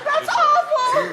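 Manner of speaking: high, drawn-out laughing exclamation; appalled outburst. Real, not acted. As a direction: cry out at an awful noise, stretching it. Reacting to the bad flute noise.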